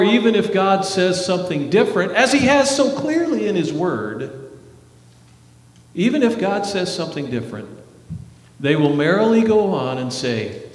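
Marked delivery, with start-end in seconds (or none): none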